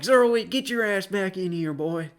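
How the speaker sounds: mock yell at a runaway boy; scolding office lady. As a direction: imitate the office lady calling you back. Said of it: "she was like".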